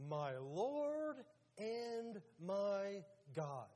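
high, loud, drawn-out proclamation; awed declaration. Acted. Thomas declares to the risen Jesus, in drawn-out awe. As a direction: stretch each word out in acted awe.

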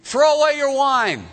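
put-on the priest rounding on a woman he thinks is drunk; hammed-up indignant rebuke; priest scolding. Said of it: "So he said".

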